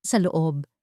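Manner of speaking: plain reading voice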